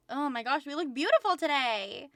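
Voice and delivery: loud, gushing excitement; excited. Real, not acted. Streamer gushing over how they look today, excited. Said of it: "I'm just constantly excited".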